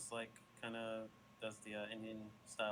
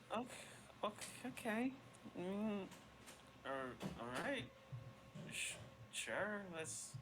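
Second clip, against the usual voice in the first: flustered fairy; 9 semitones higher, slower than usual. Acted. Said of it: "she goes".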